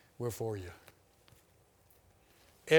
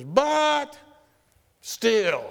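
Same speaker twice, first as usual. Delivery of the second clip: high, loud burst of stubborn insistence; stubborn shout; 9 semitones higher, 8 dB louder than usual. Retold or imitated. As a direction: bark the objection like a stubborn doubter. Doubter insisting against scriptures.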